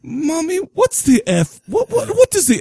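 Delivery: put-on high British kid asking Mommy; a little kid in a British accent; childish British voice, pitched high. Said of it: "how did all of a sudden I become British?".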